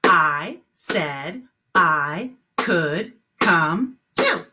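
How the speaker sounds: very slow, word by word; clapped words. Shown as a demonstration. Teacher clapping the sentence with the class, very slow. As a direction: clap each word slowly. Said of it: "Let's clap it".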